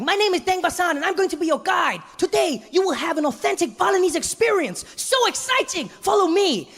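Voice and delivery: very high, bubbly guide greeting; overeager tour guide. Retold or imitated. Tour guide greets sleepy tourists, very high and bubbly. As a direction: gush like a way too happy guide. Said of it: "he's like".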